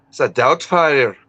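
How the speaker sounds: slow Scottish "It's a doubt fire"; Scottish accent. Shown as a demonstration. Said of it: "my Scottish accent".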